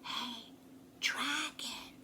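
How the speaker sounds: soft whisper of his name; softly whispered call. A narrator's reading. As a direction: whisper gently to wake him. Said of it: "Elizabeth whispered very softly".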